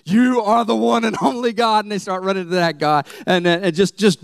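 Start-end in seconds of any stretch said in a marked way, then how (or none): 0.0-1.8 crowd's cry. Retold or imitated. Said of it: "what do the people do?".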